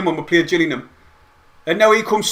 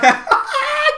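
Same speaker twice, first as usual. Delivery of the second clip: ecstatic shriek. Real, not acted. Just after the goal.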